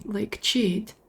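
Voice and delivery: ordinary talk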